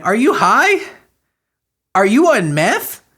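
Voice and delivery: high, pointed questions of disbelief; incredulous. Real, not acted. Commentator questions the absent mother, incredulous. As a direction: demand an answer in high-pitched astonishment.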